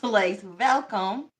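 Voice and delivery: slow "welcome" with a V; mock-accented "welcome" with a V. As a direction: stretch out "welcome" as "velcome". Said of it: "instead of W".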